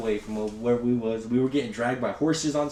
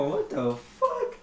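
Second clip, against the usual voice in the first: stunned disbelief; much slower than usual. Retold or imitated. Shocked kids, retold.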